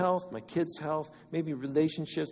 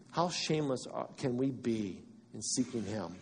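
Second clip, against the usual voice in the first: usual speaking voice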